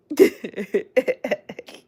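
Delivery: high, loud cackling laugh; real laugh. Real, not acted. Streamer reading a funny comment from a viewer, cracking up.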